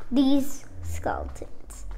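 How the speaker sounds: breathy secret whisper. Pretend. Kid whispering the news to viewers, whisper. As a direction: hush it like sharing a secret.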